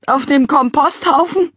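high, loud indignant cry; outraged cry. A narrator's reading. Father shocked at the compost heap, crying out. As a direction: cry out in disbelief. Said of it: "rief Mister McCrumpet".

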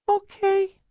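put-on very high, small child's voice; tinsel-voiced little boy. A narrator's reading. Starving boy answering caseworker, tinsel voice. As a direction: answer in a thin tinsel treble. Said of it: "he said in a tinsel voice".

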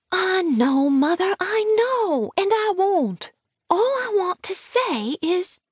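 put-on very high, drawn-out child voice; slow, high-pitched small girl, impatient to explain; protesting little girl. A narrator's reading. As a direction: protest to your mother as a stubborn child.

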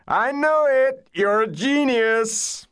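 high, drawn-out whoop of triumph; loud celebratory holler; jubilant yell. A narrator's reading. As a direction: yell it slowly and very high, overjoyed. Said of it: "He yelled".

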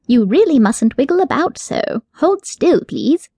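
prim rabbit, gently chiding; mild scolding. Acted. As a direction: tut at her like a fussy tutor. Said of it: "the rabbit scolded".

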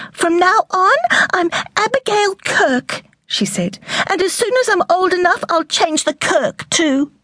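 put-on a girl claims her new name, high and resolute; determined young girl. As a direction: declare it like a stubborn young girl.

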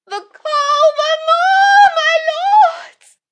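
extremely high, drawn-out wail; yelling at full lungs. Acted. Condemned woman in tears on her knees, wailing at full voice. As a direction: scream to the lords in long, piercing notes. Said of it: "she yells at the top of her lungs".

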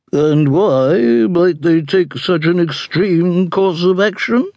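slow, high, disdainful matron voice; haughty dowager duchess. A narrator's reading. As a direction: drawl it like a scornful duchess.

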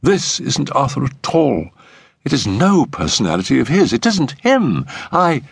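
put-on high, insistent girl's protest; distressed fiancée. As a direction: pitch climbing as she insists. Distressed fiancee protests, climbing pitch.

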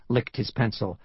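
plain reading voice

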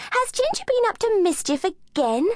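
put-on high girlish question; a child asking teasingly; young girl voice. A narrator's reading. Amy asks the gardener.

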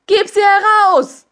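high, loud demanding shout; young officer yelling at the empress; furious shout. Acted. Young officer grabbing her arm, loud high shout. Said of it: "rief ein junger Offizier".

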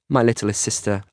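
ordinary delivery, nothing marked

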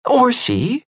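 high, light woman's voice; Greya's voice. Acted. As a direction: play the young wife, lifted up. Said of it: "she said".

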